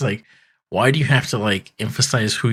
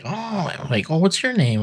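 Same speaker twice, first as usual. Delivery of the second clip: mock delighted cat; 9 semitones higher than usual. Cat voiced in retold scene.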